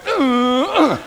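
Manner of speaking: loud high mock grunts back; copying the grunt. Preacher mimics the grunting kid back, loud mock grunts. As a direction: grunt right back as a joke. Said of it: "so I went".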